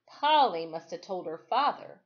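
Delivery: usual speaking voice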